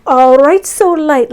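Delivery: rally the viewers with a loud rising call. Real, not acted. Launching the mantra section.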